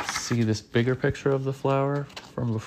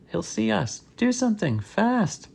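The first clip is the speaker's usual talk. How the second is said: very high, urgent pleading; panicked mock yell; 11 semitones higher than usual. A narrator's reading. Student afraid Mister Seedplot will see them, panicked. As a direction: plead in a high pitch without real volume. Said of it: "he yelled".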